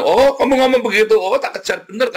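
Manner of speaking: high, mocking devil voice; the devil taunting. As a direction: sneer it like the devil chasing you. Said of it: "kata iblis". (suara iblis mengejek, tinggi dan dibuat-buat)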